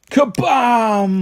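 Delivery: high, big boom; explosion noise. A narrator's reading. Narrator voicing the scribbletorium exploding. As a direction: make the bang of it blowing up.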